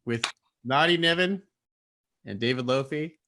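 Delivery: big broadcast-style announcement; show announcer. Real, not acted. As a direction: belt out the show's name like an announcer. Host announces the show and presenters.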